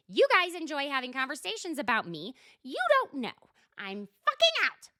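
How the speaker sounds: high, loud parting shot; imitating an angry exit; storming off. Shannon storming out.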